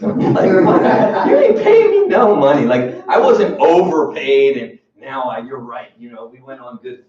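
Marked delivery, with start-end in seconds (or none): 0.6-2.6 mock indignant retort. Imagined retort to the boss.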